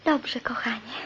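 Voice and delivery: soft whispered agreement. Acted. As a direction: breathe it tenderly. Nurse replies softly.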